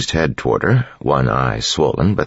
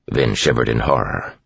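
usual speaking voice

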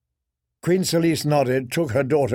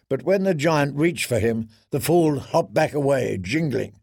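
plain reading voice

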